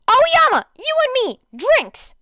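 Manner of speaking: high, loud, eager yell; shouting across the table; excited shout. Acted. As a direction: bellow it out, claiming a partner. Mina slams table, calls partner.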